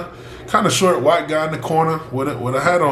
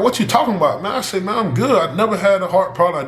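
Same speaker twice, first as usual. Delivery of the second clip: higher-pitched retold pushback; incredulous protest; 7 semitones higher, faster than usual. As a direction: protest in disbelief, voice rising high. Retold protest to team doctor.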